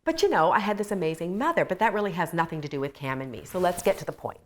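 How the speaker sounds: fast, brushing it off; impatient younger self. Retold or imitated. Wife to counselor, fast and dismissive. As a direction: hurry past the question. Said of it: "And I was like".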